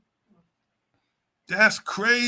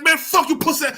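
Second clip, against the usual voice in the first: higher-pitched imitated street comeback; street talk; 7 semitones higher than usual. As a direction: lightly act out a street altercation.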